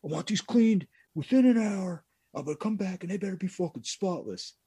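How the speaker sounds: high, loud put-on bossy old man; mocking a rude celebrity customer; Yogi Berra voice. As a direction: order the kids around in a silly high voice. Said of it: "but he's like".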